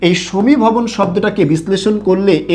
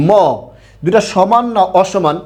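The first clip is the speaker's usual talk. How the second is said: ordinary delivery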